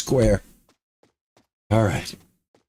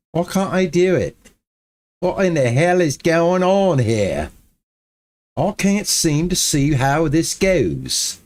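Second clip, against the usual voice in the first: higher-pitched put-on English accent; 5 semitones higher than usual. Streamer in trouble with the build, in a put-on English accent. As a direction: lament it in a mock English accent. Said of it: "I go into my English accent".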